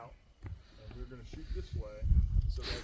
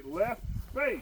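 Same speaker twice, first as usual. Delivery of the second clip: loud, higher command call; shouted drill command; 7 semitones higher, 6 dB louder than usual. Shown as a demonstration. Instructor showing the recruits the turn order, loud command. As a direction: call it out across the line. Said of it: "then we would say".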